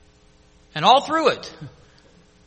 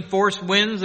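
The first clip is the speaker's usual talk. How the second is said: ordinary talk, nothing marked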